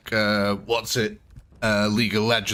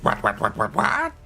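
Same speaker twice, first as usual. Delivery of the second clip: goblin voice; 3 semitones lower than usual. Acted. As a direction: chatter like a cheeky goblin. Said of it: "in that goblin voice".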